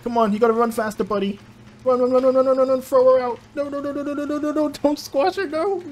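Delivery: high frantic shouting to run; being chased. Real, not acted. Urging character on during chase.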